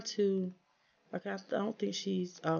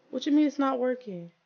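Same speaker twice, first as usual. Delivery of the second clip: indignant disbelief; 6 semitones higher, 6 dB louder than usual. Real, not acted. Game feature suddenly not working.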